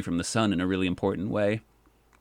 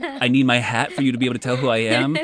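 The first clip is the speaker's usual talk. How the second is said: louder, laughing through the words; laughing disbelief; 4 semitones higher, 6 dB louder than usual. Retold or imitated. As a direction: laugh at the absurdity as you say it. Amused disbelief at not being recognized.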